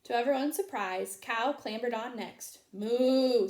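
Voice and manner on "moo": cow moo. A narrator's reading. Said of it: "said Cow".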